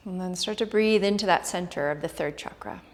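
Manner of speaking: hushed yoga class guidance; soft spa whisper. Acted. Leads a guided meditation.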